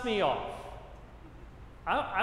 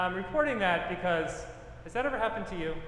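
ordinary delivery

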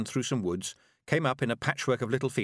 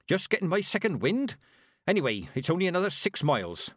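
a touch out of breath; 9 semitones higher than usual. A narrator's reading. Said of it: "She sounded out of breath".

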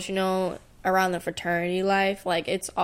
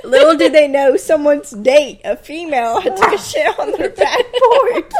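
laughing; 7 semitones higher, 9 dB louder than usual. Real, not acted. Laughing loudly while telling.

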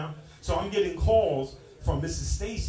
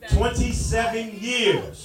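drawn out slowly, louder; slow dramatic emphasis; 5 dB louder, slower than usual. Acted. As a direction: stretch it out slowly for effect.

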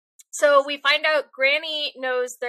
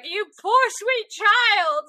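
high, slow hammed-up sobbing plea; mock bawling; 8 semitones higher, 4 dB louder, much slower than usual. Armstrong crying over the boys, hammed-up weepy wail. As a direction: wail it like a weepy big softie. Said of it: "bawling and being like".

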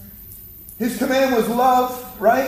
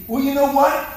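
ordinary talk

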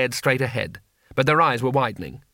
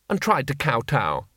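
plain reading voice

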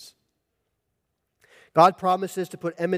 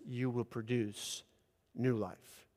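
soft divine promise; 3 semitones lower, 11 dB quieter, slower than usual. Acted. God's promise of new life.

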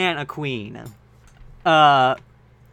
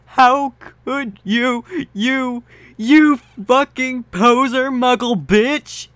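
slow, high, louder hammed-up rage; furious; 8 semitones higher, 5 dB louder, slower than usual. Draco raging at Ebony, slow furious insults. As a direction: spit out each insult slowly.

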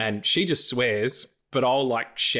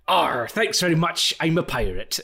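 pirate Seamus; 3 semitones higher than usual. Retold or imitated. Seamus thanking the barista, mock pirate brogue. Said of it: "He walks over".

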